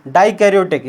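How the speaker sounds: usual speaking voice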